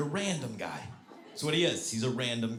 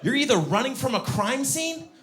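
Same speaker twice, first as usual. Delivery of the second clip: high, loud mock outrage; incredulous; 8 semitones higher, 4 dB louder than usual. Comic ranting about a jogger in jeans, incredulous. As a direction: stretch it out in baffled disbelief.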